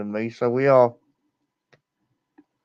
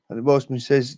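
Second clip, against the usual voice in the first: usual speaking voice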